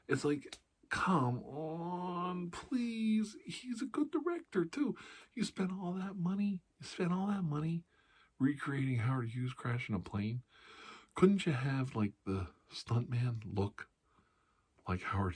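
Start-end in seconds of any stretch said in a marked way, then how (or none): 0.9-10.4 pitched way up in disbelief, pleading; exasperated high rant. Real, not acted. Comic to audience about miscasting, high exasperated squeal. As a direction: squeal it out like you can't believe it.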